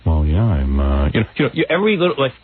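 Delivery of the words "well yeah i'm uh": very low, halting guest impression; mock a tongue-tied guest in a low voice; George Clooney. Host imitating the bad guest fumbling an answer, low halting mumble. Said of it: "he was like".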